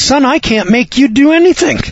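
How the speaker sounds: a hint of a stern father looking him in the eye; daddy's voice.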